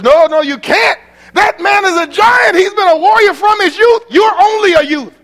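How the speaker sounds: high, loud pleading protest; alarmed warning. Acted. Saul loudly pleading with David not to fight. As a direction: shout him down in alarm.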